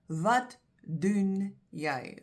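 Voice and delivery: Wat doen jy drawn out very slowly, falling on Wat; slow demo. Shown as a demonstration. Teacher modelling the correct Afrikaans use, very slowly. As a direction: stretch each word of Wat doen jy for the learner. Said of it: "then you use".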